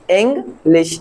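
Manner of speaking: इंग्लिश in two beats, ing, then lish; इंग्लिश cut into ing and lish; split into syllables. Shown as a demonstration. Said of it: "दो pronunciation निकल रही है".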